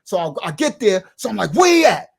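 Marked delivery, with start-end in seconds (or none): 1.5-2.1 high, loud demand; retold loud demand; demanding shout. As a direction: call out for him, loud. Arriving back, demanding.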